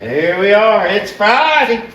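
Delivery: high, slow, drawn-out whoop; excited announcement. Pretend. Retaking the day announcement for the viewers, high and drawn out.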